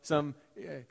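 usual speaking voice

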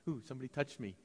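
icky germaphobe. Pretend. Pastor teasing the squeamish congregation, soft mock disgust. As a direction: recoil lightly like a germaphobe. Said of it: "Jesus isn't a germaphobe, like, icky".

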